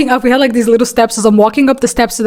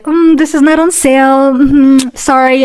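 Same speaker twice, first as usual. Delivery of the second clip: sweet fake-polite cashier tone, drawn-out mm; passive-aggressive; 3 semitones higher, slower than usual. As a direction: smile while refusing, sugary and tight. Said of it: "the little passive aggressiveness".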